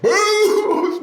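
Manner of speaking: very high loud "boo" of a helmet hit; mock crash noise; loud smash sound. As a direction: belt out the helmet impact.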